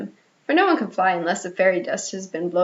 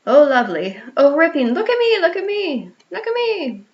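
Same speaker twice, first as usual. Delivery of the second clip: higher, louder cries that fade; delighted; 6 semitones higher, 3 dB louder than usual. A narrator's reading. Children flying round the nursery, excited cries. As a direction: call out in pure delight.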